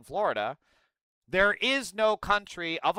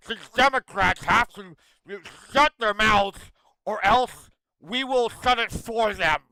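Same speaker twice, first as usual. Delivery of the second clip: raised, slowed mock menace in a put-on voice; mock Tom Oman; 5 semitones higher, 7 dB louder than usual. Streamer mocking Tom Oman's threat, higher mock menace. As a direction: ham up a tough-guy threat, louder. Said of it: "He's like".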